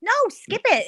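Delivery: high, loud insistent no; emphatic correction. Real, not acted. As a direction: correct them with a burst.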